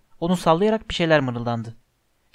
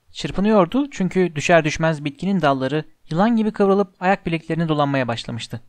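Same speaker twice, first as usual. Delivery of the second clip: plain reading voice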